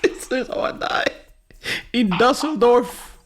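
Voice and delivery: high voice, breaking up with laughter; laughing disbelief. Real, not acted. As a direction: repeat it helplessly through laughs. Repeats the doom, laughing.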